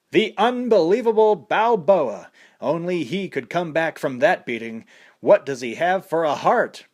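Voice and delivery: boxing announcer calling the action; fight commentator. A narrator's reading.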